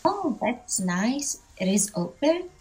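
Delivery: ordinary delivery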